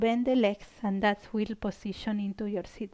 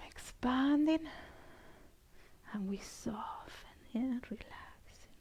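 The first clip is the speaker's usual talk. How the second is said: soft whisper to the class; whispered cue; 9 dB quieter than usual. Real, not acted. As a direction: breathe the words out, barely voiced. Instructor whispers breathing cues.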